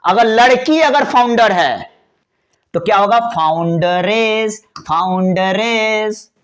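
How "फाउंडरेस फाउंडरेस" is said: फाउंडरेस drawn out twice; फाउंडरेस said slowly for notes; slow teaching word. Shown as a demonstration. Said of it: "फाउंड, फाउंड".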